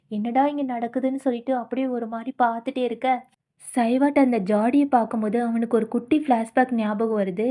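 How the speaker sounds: usual speaking voice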